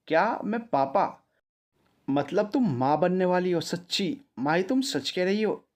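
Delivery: high, excited gasping questions; can't believe the happy news; overjoyed disbelief. Acted. Husband hearing he will be a father, excited and astonished.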